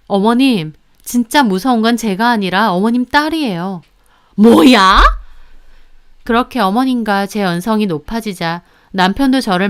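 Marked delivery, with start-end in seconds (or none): 4.3-5.0 outraged snap. Acted. Mother-in-law outraged retort.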